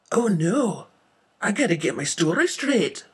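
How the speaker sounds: loud, mildly panicked exclamation; acted panic. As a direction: fret out loud in alarm. Witness panics before her interview.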